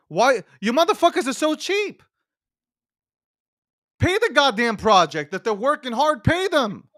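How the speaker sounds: angry rant. Real, not acted. Ranting at cheap buyers.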